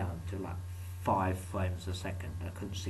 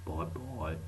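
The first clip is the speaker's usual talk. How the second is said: lower, softer goodbye; low bye; 5 semitones lower, 5 dB quieter than usual. Acted. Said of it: "why I said that like that".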